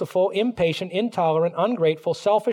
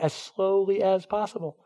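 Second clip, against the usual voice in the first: normal talking voice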